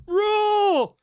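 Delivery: a mock Ramsay yell over a T-Rex; Gordon Ramsay dinosaur roar. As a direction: roar it like a furious TV chef. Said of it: "voiceover of Gordon Ramsay just on every dinosaur".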